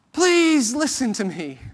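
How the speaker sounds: high, loud, slow plea; pleading. Retold or imitated. People crying out to be heard, slow pleading. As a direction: stretch out the begging.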